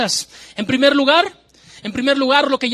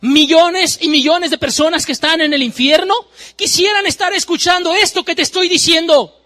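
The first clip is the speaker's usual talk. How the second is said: louder, higher preacher's cry of woe; preaching at full force; 8 semitones higher, 5 dB louder than usual. Acted. Preacher crying woe over those who ignore the eternal, loud and high. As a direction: warn the sinners from the pulpit.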